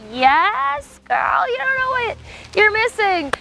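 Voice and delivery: high, sassy put-on voice; sassy reply. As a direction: tease the friend in a high sassy voice. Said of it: "And I'm like".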